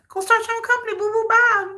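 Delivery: high sassy taunting voice; mock them in a high sneering voice. Mocking voice telling them leave.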